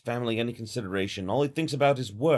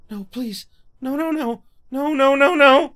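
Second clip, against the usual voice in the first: building from pleading to loud high panic; panicked pleading; 12 semitones higher, 10 dB louder than usual. Acted. Mayu trapped and terrified, rising panicked pleas. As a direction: beg harder with every no.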